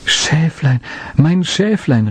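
high, glad calling out; joyful call. A narrator's reading. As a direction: cry out in relief at finding the lamb. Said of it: "rief er freudig". (freudig gerufen, hoch)

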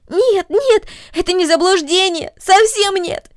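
high, loud, distressed protest; frightened girl pleading with the mother; anxious outcry. Acted. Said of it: "воскликнула Корнели в сильном беспокойстве".

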